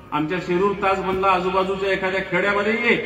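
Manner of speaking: normal talking voice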